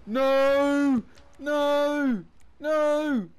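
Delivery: high, loud repeated no; panicked shouting. Real, not acted. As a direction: yell no in rising panic. His car heading toward a hole.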